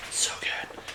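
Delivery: a savoring little whisper; whispered aside. Real, not acted. Reader whispering an aside about the music, real whisper. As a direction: whisper it to yourself.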